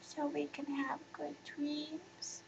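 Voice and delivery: put-on barely audible sleepy child; drowsy, slow whispered question. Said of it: "Shala's sleepy voice could just barely be heard".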